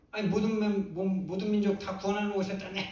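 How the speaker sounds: high, loud mock-indignant challenge; acted quarrelling. Preacher as unbelieving mother confronting Jesus, acted quarrelling. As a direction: pick a fight, pitch jumping up. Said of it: "막 싸우쳐야죠".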